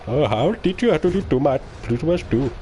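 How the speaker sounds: put-on quick parody in an Indian accent. Streamer replaying his maths-tutor parody, put-on Indian accent. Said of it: "doing an Indian accent".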